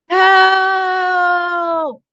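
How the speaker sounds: long, high, full-voiced yell; shout for help. Shown as a demonstration. Teacher showing how a vowel lets you shout, full yell. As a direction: call out with all your breath. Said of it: "now I can call".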